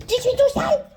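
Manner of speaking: put-on high, loud childish whine; high whiny toddler voice; mock whine. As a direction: complain like a sulky toddler. Voicing her own mess childishly.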